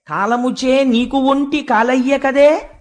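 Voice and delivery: slow, drawn-out chanting of a Telugu verse; poem recitation. Acted. The earth answers the bull in verse, slow chanted recitation. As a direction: intone each line slowly like a padyam.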